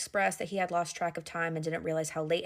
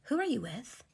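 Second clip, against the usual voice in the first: higher-pitched accusing question, re-enacted; retold suspicious demand; 6 semitones higher than usual. Wife hears a woman in the car, retold suspicious demand. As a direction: question him as if catching him out. Confronting husband after hearing woman's voice.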